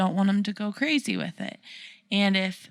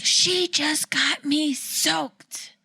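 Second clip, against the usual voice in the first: lightly voiced upset daughter, slow and higher; kid voice; 7 semitones higher, much slower than usual. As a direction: whine it like a soaked kid. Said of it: "And Nora's like".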